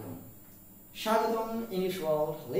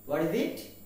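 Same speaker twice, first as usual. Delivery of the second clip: usual speaking voice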